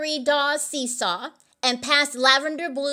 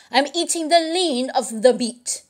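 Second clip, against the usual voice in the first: put-on character voice, affronted; huffy Jack Sprat; 3 semitones higher than usual. A narrator's reading. Jack Sprat defending his dinner, huffy character voice. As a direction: explain with huffy dignity. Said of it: "huffed Jack Sprat".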